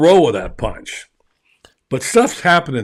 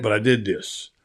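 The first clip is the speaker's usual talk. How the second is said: ordinary talk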